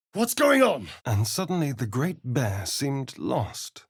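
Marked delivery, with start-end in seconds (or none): 0.1-0.9 high, loud alarmed question; sudden frightened cry; startled shout. Acted.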